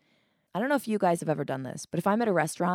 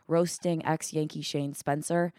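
ordinary talk

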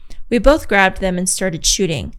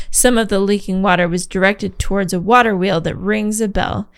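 ordinary delivery

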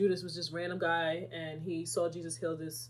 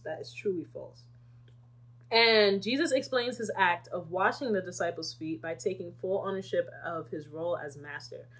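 usual speaking voice

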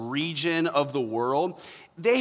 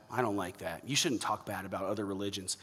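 much lower and softer, a whiny mock of the offended; whiny mock complainer; 7 semitones lower, 10 dB quieter than usual. Pastor mimicking offended churchgoers, low whiny mock. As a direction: sulkily parody the upset churchgoer. Imitating upset churchgoers leaving service.

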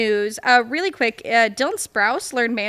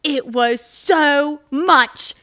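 mock Bruno Tonioli; 3 dB louder, much slower than usual. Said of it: "I'm not doing the accent 'cause I can't".

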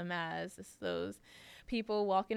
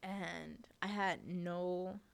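usual speaking voice

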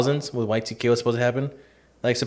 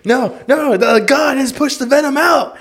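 much higher, louder, slow ecstatic cry; mock revival preacher; 13 semitones higher, 6 dB louder, slower than usual. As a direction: testify like the spirit took you. Joins mock preacher bit.

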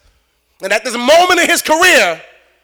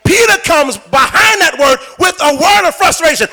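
usual speaking voice

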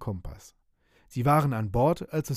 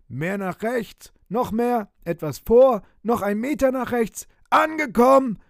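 loud, high shouted orders over the radio; shouting; 10 semitones higher, 8 dB louder than usual. A narrator's reading. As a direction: call out directions, building to a triumphant yell. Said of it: "schrie er".

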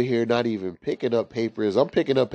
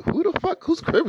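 startled high squeak. Retold or imitated. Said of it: "I'm like".

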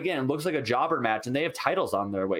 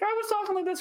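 mock squeaky voice, far above usual pitch; high-pitched pained voice; faster than usual. Shown as a demonstration. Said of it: "my voice pitch went up".

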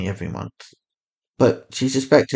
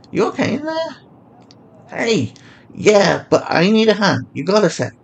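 lightly voiced higher woman; a hint of a friendly stranger; woman's voice; 10 semitones higher than usual. Woman voice in dialogue.

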